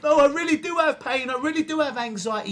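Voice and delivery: high, loud mock protest; defensive client.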